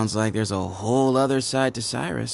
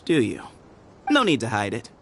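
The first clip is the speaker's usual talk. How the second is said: ordinary delivery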